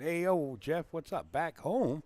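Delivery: high, loud, happy hello to a buddy; excited loud greeting. Real, not acted. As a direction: greet him big across the room. Loud greeting to arriving friend.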